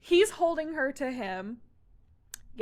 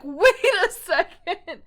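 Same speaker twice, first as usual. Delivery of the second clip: higher, louder, drawn-out double take; mock dawning realization; 5 semitones higher, 8 dB louder than usual. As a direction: slowly catch on, acting it out.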